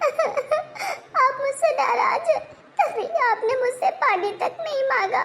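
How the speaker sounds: high, sobbing melodramatic whine; whiny fake crying. Wife sobbing at husband over not asking her for water, whiny fake crying. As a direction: wail like a wronged wife craving attention.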